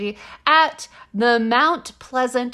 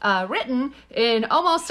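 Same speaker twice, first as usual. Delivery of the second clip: ordinary delivery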